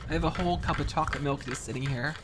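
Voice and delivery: ordinary talk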